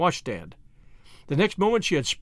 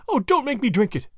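higher-pitched pleading moan; 6 semitones higher than usual. A narrator's reading. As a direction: beg desperately, moaning the words. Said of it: "she moaned".

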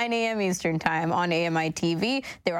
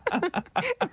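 high burst of laughter; 13 semitones higher than usual. Real, not acted. Laughing at donut joke.

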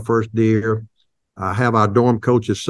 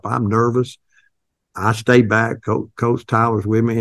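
ordinary talk

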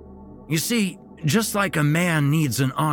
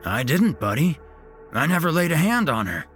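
higher grandpa voice, a touch wounded; hurt denial; 9 semitones higher, 4 dB louder, faster than usual. A narrator's reading. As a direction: deny it sounding hurt. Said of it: "Pop Pop looked hurt".

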